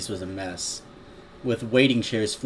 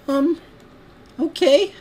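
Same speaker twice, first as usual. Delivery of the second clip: put-on very high, hesitant child; badly faked little-girl falsetto; 6 dB louder, slower than usual. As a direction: squeak it out like an unsure filly. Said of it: "I can't do a good little girl voice".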